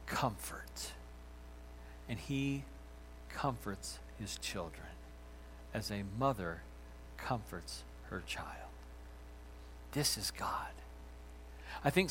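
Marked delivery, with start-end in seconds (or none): 0.1-2.6 whispered. Real, not acted. Soft climax of sermon line. 3.3-8.7 hushed and tender. Real, not acted. Tender sermon close, voice dropping. 9.9-10.7 whispered This is God. Real, not acted. Whispered emphasis after comfort image.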